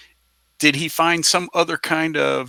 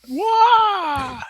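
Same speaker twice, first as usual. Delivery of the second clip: high-pitched "wah" of someone falling; playful high wail; sliding-down yell. Acted. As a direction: cry out as you shoot down a chute. Acting someone sliding down a chute.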